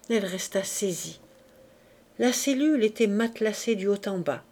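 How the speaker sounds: plain reading voice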